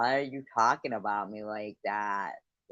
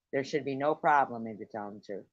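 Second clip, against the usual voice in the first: normal talking voice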